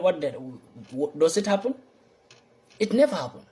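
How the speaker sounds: ordinary talk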